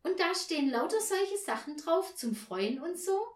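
put-on high, loud child's question; eager child. A narrator's reading. Child at bedtime asks about the notes, high eager voice. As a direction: ask hopefully in a child's pitch.

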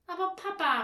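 put-on high, loud desperate little girl; desperate child. Girl pleads with her father, high and desperate. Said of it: "rief Tina verzweifelt".

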